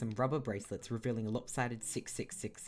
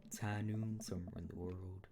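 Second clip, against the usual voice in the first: low, soft gunslinger line; quiet, low cowboy catchphrase; 4 semitones lower, 7 dB quieter than usual. Acted. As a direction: murmur it like a western gunslinger. Warming up a cowboy voice.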